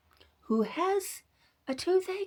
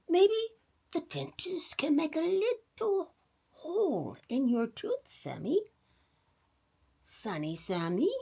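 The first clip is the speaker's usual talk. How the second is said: grandma voice; 9 semitones higher than usual. Acted. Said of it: "exclaimed Grandma".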